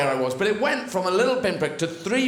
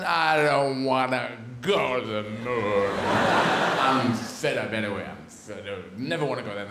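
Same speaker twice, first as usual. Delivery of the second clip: sulky voice; 7 semitones lower than usual. Pretend. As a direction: sulk like a fed-up kid.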